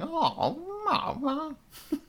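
very high, soft mock whine; imitated whiny noise. Mimicking the buses' sound now, high whiny noise. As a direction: whine wordlessly like a complaining bus. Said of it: "they just make whiny noises".

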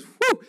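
sudden high "woo"; high loud whoop. Real, not acted. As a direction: let out a quick, loud whoop to psych yourself up. Bracing himself before embarrassing confession.

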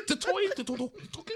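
laughing mock gibberish.

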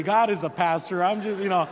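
ordinary delivery

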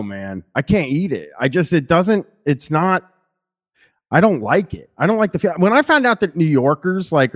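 ordinary talk, nothing marked

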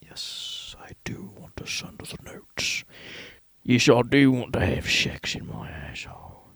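breathy whisper, close to the mic; joking whisper. Acted. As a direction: whisper it like a naughty secret. Whispered joke about sending notes.

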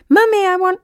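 put-on high, loud little child's demand; small child nagging its mummy, high and loud; whiny child. A narrator's reading. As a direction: squeal it like a toddler wanting something. Children interrupting calls.

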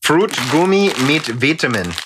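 put-on German accent; reading German. Host showing off reading German labels, mock German accent. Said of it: "Did you see me read German there?".

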